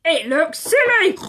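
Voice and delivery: put-on a sulky young piglet; lightly voiced grumpy piglet. Tom's thought voiced.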